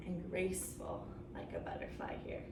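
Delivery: soft unvoiced whisper. Real, not acted. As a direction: whisper it like a butterfly. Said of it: "Soft, quiet".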